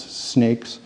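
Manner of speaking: ordinary delivery, nothing marked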